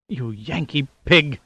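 slow, spat-out hissing insult; spitting insult. A narrator's reading. Captive woman cursing her captor, slow spat insult. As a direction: snarl it at him like a cornered cat. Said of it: "she cried".

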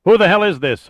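sharp, loud, high-pitched snap; snapped. A narrator's reading. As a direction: snap back at an unknown caller. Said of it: "Hollis snapped".

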